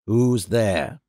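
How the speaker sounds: low, startled challenge from a lightly voiced man; a dazed man challenging a child, low and hard; terrible voice. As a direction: call out low into the dark. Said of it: "cried in a terrible voice".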